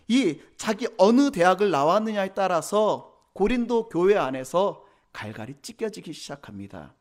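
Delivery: ordinary talk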